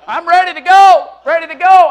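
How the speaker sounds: loud, high acted eagerness; raring to go. Football fan tells the pastor he's ready, hammed-up excitement. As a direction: belt it out like a pumped-up fan.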